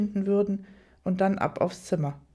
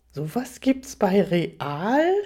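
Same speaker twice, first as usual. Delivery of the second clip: slow incredulous question, drawn out; stunned disbelief; 3 dB louder, much slower than usual. A narrator's reading. Narrator to Rainer about the store, stunned disbelief. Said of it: "fragte ich fassungslos".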